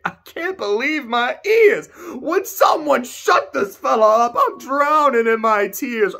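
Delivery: high, slow theatrical lament; melodramatic villain lament. Acted. Kuja hamming up his doom to the party, high and slow. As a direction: wail it like a hammy stage villain.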